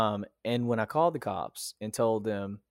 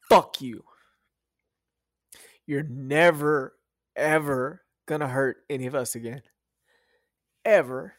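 defiant threat; 2 semitones higher, 4 dB louder than usual. Retold or imitated. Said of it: "this is in my mind".

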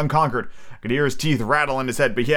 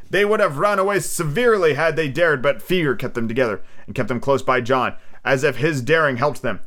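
usual speaking voice

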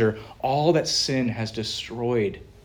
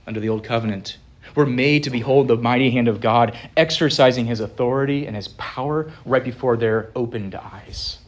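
ordinary talk

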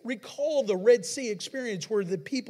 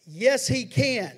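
slow, louder Yes, he can; response; 3 semitones higher, 7 dB louder, much slower than usual. Shown as a demonstration. Preacher modeling the congregation's answer, slow and firm. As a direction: answer back firmly. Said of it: "you will say".

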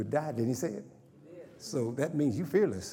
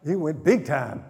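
a loud, emphatic reaction; louder reaction; 3 semitones higher, 7 dB louder than usual. Real, not acted. Preacher marveling at Peter's denial, louder. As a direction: punch it out louder.

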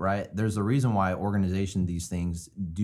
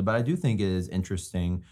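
ordinary delivery

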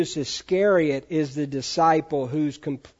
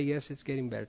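wife's reply; 2 semitones lower, 10 dB quieter, much faster than usual. Retold or imitated. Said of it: "would you say".

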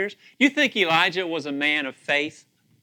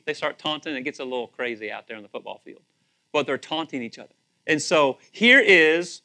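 ordinary delivery

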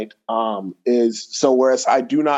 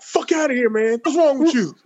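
angry yelling; 12 semitones higher than usual. Retold or imitated. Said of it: "and it was like".

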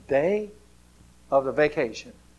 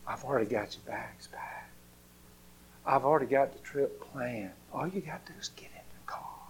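soft, whispered reassurance, much softer; gentle whisper from God; 14 dB quieter than usual. Acted. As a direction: lean in and whisper the promise like a loving father.

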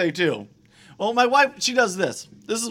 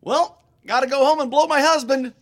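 imitated wife's raised-pitch public announcement; mock farewell announcement, building louder; announcing to bar; 6 semitones higher, 5 dB louder than usual. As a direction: call it out to the whole room, higher. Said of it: "she'll announce the entire bar".

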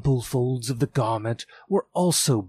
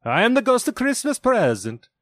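high, fast, otherworldly spirit voice; echoing ethereal energy being; 11 semitones higher, 3 dB louder, faster than usual. A narrator's reading. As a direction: proclaim it like a towering jolly ghost. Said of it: "said the spirit".

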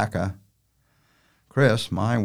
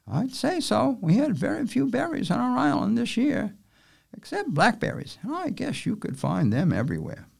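put-on young woman voice; 12 semitones higher than usual. Sister answers in character.

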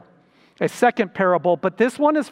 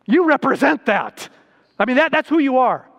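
mock voice of God; 4 semitones higher, 4 dB louder than usual. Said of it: "He's saying".

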